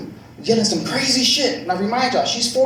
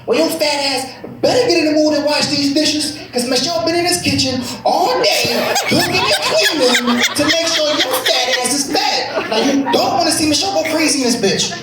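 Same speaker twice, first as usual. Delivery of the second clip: high, hammed-up scolding wife voice; angry wife rant; 7 semitones higher than usual. Wife scolding her husband about the dishes, hammed-up furious rant. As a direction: rant at him like a furious wife, played for laughs. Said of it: "Her response was".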